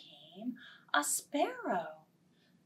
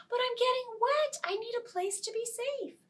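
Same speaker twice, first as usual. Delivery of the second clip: high, fast plea; pleading butterfly; 13 semitones higher, faster than usual. Acted. Butterfly pleading with the ant, high and fast. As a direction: beg quickly in a high voice.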